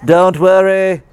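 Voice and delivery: poking fun while paraphrasing Jesus's words; mock Jesus. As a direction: lightly mimic a carefree preacher. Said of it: "or whatever, right?".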